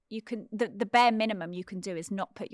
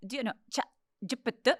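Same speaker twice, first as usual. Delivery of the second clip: Swedish twang; 4 semitones higher, much faster than usual. Retold or imitated. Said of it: "Swedish kind of twang".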